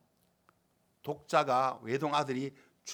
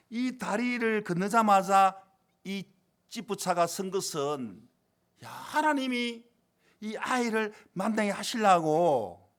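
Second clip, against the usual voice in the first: slow, loud, stretched-out proclamation; impassioned preaching; 7 semitones higher, 6 dB louder, slower than usual. Real, not acted. Preacher revealing God's purpose in the breakdown, impassioned preaching. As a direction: draw each phrase out with force.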